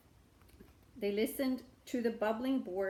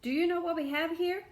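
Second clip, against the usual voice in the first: beggar asking; 8 semitones higher, 5 dB louder than usual. A narrator's reading. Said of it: "asked the beggar".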